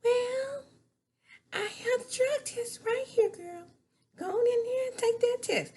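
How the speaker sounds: high put-on Melanie voice; squeaky quoted Melanie. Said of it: "Melanie's like".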